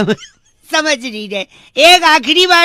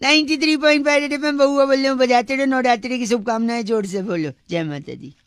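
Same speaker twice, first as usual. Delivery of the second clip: radio jockey sign-off; 8 dB quieter than usual. Acted.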